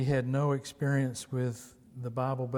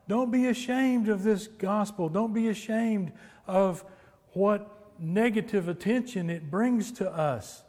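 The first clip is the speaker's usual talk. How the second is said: high, urgent exhortation to the congregation; impassioned preaching; 6 semitones higher, 4 dB louder than usual. Real, not acted. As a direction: preach it insistently, pitched well up. Preaches Paul's exhortation with force.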